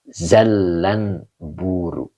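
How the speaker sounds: "Zallan buru" very slow, with a doubled l in "Zallan"; teacher modelling "Zallan" with a lengthened l; slow demo reading. Shown as a demonstration. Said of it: "Makanya tadi bacanya zal".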